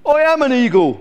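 high, loud indignant bellow; outraged shout. Acted. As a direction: bellow like an affronted eagle. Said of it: "bellowed Glen".

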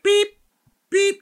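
high loud beep, beep; hospital monitor beep. Retold or imitated. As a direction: chirp like a heart monitor. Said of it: "photorealistic beeps in the back".

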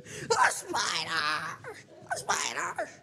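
high imitated shriek of a scared girl; hammed-up child's fright; mock scream. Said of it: "screaming afraid".

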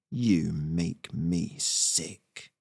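very slow, low contempt; disgusted. Acted. Squirrel leader spitting at the beaver, low slow disgust. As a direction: draw out each word low with loathing.

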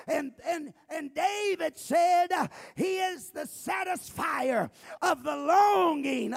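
very loud, high, impassioned sermon; full-force preaching. Real, not acted. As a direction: shout the assurance out to the whole congregation.

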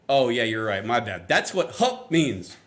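low, mildly sarcastic giving in; mock resignation. Giving in to his defiant son, dry sarcasm. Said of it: "And I went".

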